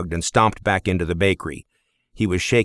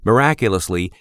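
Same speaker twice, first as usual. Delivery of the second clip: ordinary delivery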